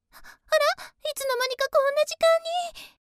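high, loud flustered surprise; startled realisation. Acted. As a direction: notice the time with a jolt. Suddenly notices how late it is.

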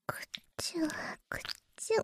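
wet licking sounds. Acted.

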